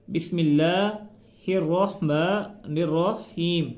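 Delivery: slow spoken बिस्मिल्लाहिर्रहमानिर्रहीम without melody; slow plain reading. Shown as a demonstration. Teacher reading Bismillah slowly and plainly. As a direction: read it slowly and evenly. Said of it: "ठीक से अदा नहीं करते".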